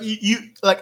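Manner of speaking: normal talking voice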